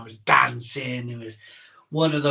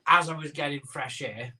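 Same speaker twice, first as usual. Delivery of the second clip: ordinary talk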